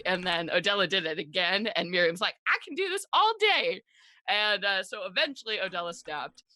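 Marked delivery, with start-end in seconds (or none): 2.5-3.8 high, cocky and sing-song; defiant Miriam. Retold or imitated. Recap of Miriam countering Odella, cocky high taunt. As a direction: taunt her like you could go forever.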